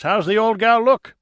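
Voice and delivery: eager question, pitched high and loud; excited. A narrator's reading. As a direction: ask it bursting with excitement. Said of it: "with excitement in his voice".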